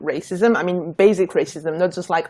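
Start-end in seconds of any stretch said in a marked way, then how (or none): none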